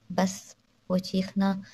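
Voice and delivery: plain reading voice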